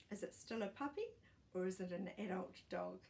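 usual speaking voice

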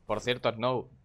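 ordinary delivery

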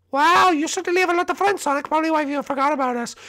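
very high, cartoonish friend voice; tiny high voice, mildly miffed; squeaky cartoon figure; high-voiced little character.

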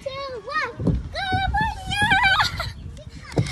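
high excited cheer. Real, not acted. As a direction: cheer her on as she slides. Cheers friend down the slide.

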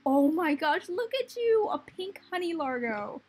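high, loud squeal of delight; delighted gushing. Real, not acted. Gamer spotting a newly changed pink slime, delighted gushing. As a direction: burst out loud and climb high on the last words.

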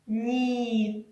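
ні: soft н, bright і. Shown as a demonstration. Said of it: "а м'яко скажемо".

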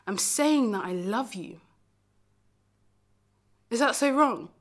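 pleading, indignant self-defence; acted wounded defiance; defiant hurt. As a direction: protest it, pushing the key words. Defends needing the one they loved.

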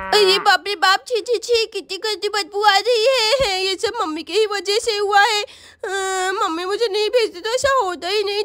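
usual speaking voice

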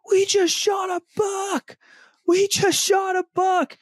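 high-pitched ecstatic squeal, slow and drawn out; squealing with joy. Retold or imitated. Boy squealing to his dad after the shot, ecstatic shriek. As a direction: shriek it like a kid who just got his first buck. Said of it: "starts squealing, squalling".

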